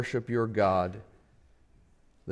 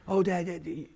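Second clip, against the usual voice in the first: imitating daughter; 4 semitones higher, 4 dB quieter, faster than usual. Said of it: "daughter voiced in story".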